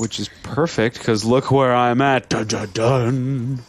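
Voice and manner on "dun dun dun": slow ominous sting; dramatic dun dun dun. Pretend. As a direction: hum a spooky reveal. Ominous building reveal.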